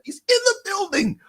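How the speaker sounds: very high, incredulous repeat; exasperated outburst. Real, not acted. Host hammering the point to Anna, exasperated. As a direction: pitch it way up in exasperation.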